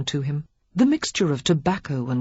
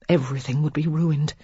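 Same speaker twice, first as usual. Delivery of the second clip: usual speaking voice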